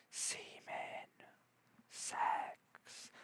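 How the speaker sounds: slow, drawn-out whisper; hushed suggestive aside, fully whispered; whispered innuendo. Shown as a demonstration. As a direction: whisper it slowly like a naughty secret. Hinting at what blood really means.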